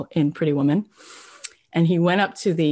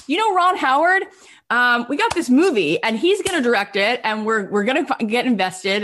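high, louder salesy pitch; excited; 7 semitones higher, 3 dB louder than usual. Retold or imitated. Brian pitching to Tom Hanks, high and eager. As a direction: sell it hard.

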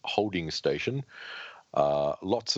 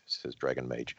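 usual speaking voice

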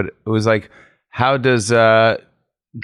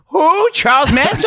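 high, loud, incredulous grandma squawk; mishearing grandma; 4 dB louder than usual. Acted. Grandma mishearing grandson on buzzer, high alarmed squawk. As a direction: squawk the question in disbelief. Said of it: "buzzing into my grandma's".

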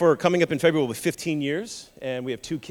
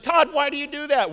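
high complaining; wife scolding his teasing; exasperated wife; 9 semitones higher than usual. Retold or imitated. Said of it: "and she's like".

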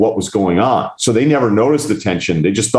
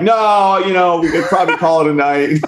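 imitating the guy; 9 semitones higher than usual. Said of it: "And the guy's like".

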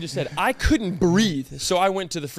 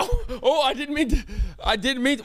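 startled; 6 semitones higher than usual. Pretend. Startled apology in role-play.

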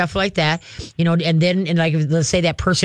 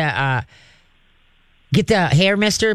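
ordinary talk, nothing marked

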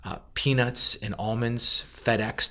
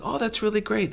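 much higher, sing-song fake enthusiasm; mock delight; 12 semitones higher than usual. Distributor answering Justin, high mock delight. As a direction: gush with put-on delight. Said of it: "And they're like".

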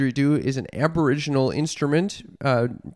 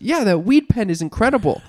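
higher-pitched mimicry of mom; mother imitation; 6 semitones higher, 3 dB louder than usual. Daughter quotes mom praising weed pen, mimicking her enthusiasm. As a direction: imitate mom raving, voice lifted. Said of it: "She goes".

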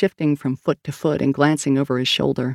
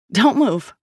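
shouted order; 6 semitones higher than usual. A narrator's reading. Detective ordering the two in the van, shouted. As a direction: bark it to freeze them. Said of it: "Sal shouted".

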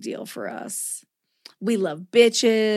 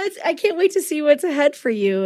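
ordinary talk, nothing marked

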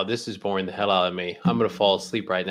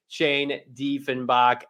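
ordinary talk, nothing marked

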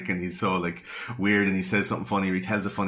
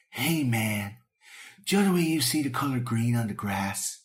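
put-on stoned young philosopher; stoner musing. Stoned friend puzzling over colours with a mate, put-on stoner voice. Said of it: "that weed conversation where you're like".

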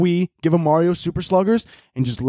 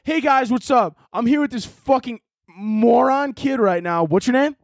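mock vlogger greeting; 9 semitones higher, slower than usual. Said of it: "He's like".

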